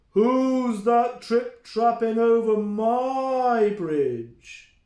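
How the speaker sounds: slow, loud troll roar. Acted. The troll challenges the goat, slow loud roar. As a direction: roar it out, dragging the words. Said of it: "he roared".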